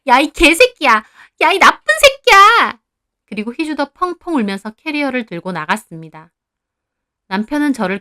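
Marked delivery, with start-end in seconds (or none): 0.1-2.8 loud, high wailing curses; tearful screaming. A narrator's reading. Heeju throws a tissue box at her lover, screaming curses. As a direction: hurl insults, building to a shriek. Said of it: "엉엉 울면서 남편에게 티슈각을 집어 던지더라고요".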